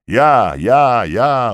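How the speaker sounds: high, loud chorus of answers; eager shouts. A narrator's reading. Soldiers answering the duty soldier, loud.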